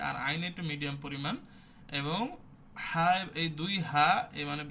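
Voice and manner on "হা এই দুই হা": ha, with air flowing out; breathy হা demo; breathy ha sound. Shown as a demonstration. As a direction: let the air run through হা. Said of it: "বাতাসও জারি রাখতে হয়".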